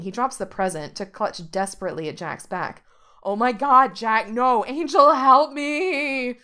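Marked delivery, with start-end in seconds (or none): 3.2-6.4 loud, high panicked yelling; loud high-pitched panic. A narrator's reading. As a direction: scream for help while being carried off. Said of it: "he yells".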